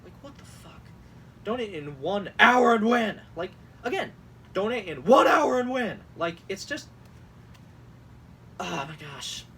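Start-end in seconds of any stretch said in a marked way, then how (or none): 1.4-3.2 "hour and win" suddenly shouted; stress on ONE HOUR AND WIN. Acted. Host mocks text capitals, shouting "hour and win". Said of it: "what's with emphasizing these weird parts". 4.5-6.0 "one hour and win" shouted high; stress on ONE HOUR AND WIN. Acted. Host repeats the text, shouting "one hour and win". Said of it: "what's with emphasizing these weird parts".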